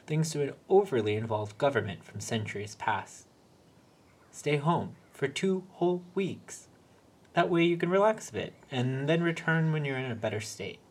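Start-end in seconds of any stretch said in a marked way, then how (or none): 4.3-10.8 coaxing manager voice. Acted. Floor manager coaxing Peter.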